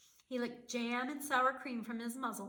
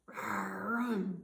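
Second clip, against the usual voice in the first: a touch of a growl; bear growl; 2 semitones lower, 3 dB louder than usual. Acted. Bear growls at Baba Brena over the nuts, mild growl. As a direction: growl back mildly. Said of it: "growled Old Bear".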